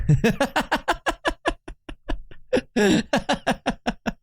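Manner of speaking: long high-pitched wheezing laugh; a drawn-out fit of giggles. Real, not acted.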